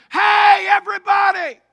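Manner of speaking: hearty southeast Missouri shout; southeast Missouri holler. Acted. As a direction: holler it southeast Missouri style to the whole crowd. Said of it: "let's put it in southern, southeast Missouri vernacular".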